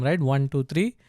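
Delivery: usual speaking voice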